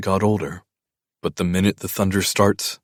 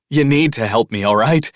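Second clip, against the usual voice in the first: higher, louder, desperate begging; panicked pleading; 4 semitones higher, 5 dB louder than usual. A narrator's reading. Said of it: "he pleads, his voice in a panic".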